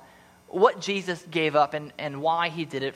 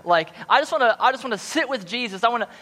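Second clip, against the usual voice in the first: higher, louder, earnest wanting; eager longing; 8 semitones higher, 5 dB louder than usual. Real, not acted. Pastor describing childlike faith, eager and earnest.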